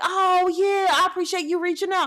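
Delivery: bright, eager and loud, pitched well up; thrilled the call came; so happy. Retold or imitated. Man thanking her for calling, delighted and loud. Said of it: "so happy".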